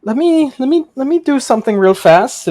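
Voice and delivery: high, loud nervous voice; nervous shopkeeper. Acted. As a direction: rush it out, rattled. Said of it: "he goes".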